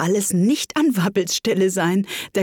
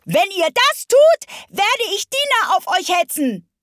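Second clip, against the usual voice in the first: shouting loud; 14 semitones higher, 5 dB louder than usual. Acted. Alice threatens the animals, loud high shout. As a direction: yell it as loud as you can. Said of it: "schrie Alice so laut sie konnte".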